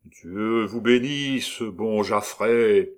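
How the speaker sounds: slow lugubrious chorus. Acted. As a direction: intone it like a dirge. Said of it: "d'une seule voix, lente, lugubre et profonde".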